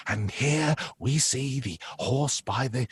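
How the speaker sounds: slow, generic nature-documentary narrator; David Attenborough. Acted. Showing a client a poor imitation, generic documentary voice. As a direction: draw it out like a hushed wildlife presenter. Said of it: "can't just sound like a generic".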